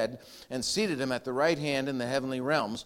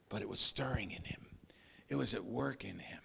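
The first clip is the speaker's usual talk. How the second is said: much softer, near whisper; hushed; 6 semitones lower, 11 dB quieter than usual. Real, not acted. Preacher hushed over what stirred in Jesus. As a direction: drop to a hush of awe.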